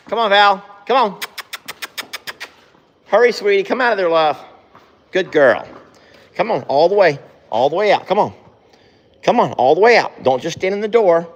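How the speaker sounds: high, sweet coaxing; coaxing horse. Real, not acted. Owner coaxing Val out of the stall, high and sweet. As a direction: sweet-talk her out, sing-song.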